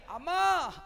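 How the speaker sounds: a ringing call of అమ్మా; loud call. Acted. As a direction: call out to her from across the garden. Jesus calls out to Mary. (యేసు మరియను పిలుస్తూ గట్టిగా అమ్మా అని పిలుపు)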